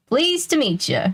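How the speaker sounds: a hint of a Texas cowboy drawling hello. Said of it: "he drawled".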